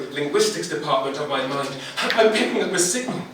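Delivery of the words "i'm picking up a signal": high, loud, eager intern; raised, excitable intern voice; squeaky intern. A narrator's reading. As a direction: pipe up like a junior intern. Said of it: "squeaked an intern".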